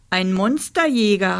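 usual speaking voice

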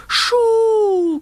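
long high hooting call; hollow owl hoot. A narrator's reading. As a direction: hoot eerily from a hollow tree. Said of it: "klingt es dumpf aus".

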